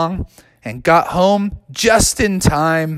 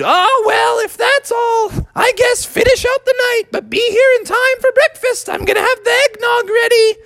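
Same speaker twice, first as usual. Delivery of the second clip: very high falsetto cartoon mother; relenting mom. Mom lets her child finish the night, high relenting voice. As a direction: give in, keeping the piping mom voice. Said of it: "And Froggy's mom said".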